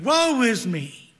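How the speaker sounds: slow, loud cry of woe; Isaiah crying out in slow, loud despair; anguished cry. Retold or imitated. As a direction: cry out as a man undone before God. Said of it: "Isaiah cried".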